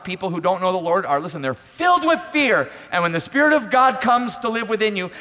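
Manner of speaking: high, loud pulpit proclamation; full-force preaching. Real, not acted.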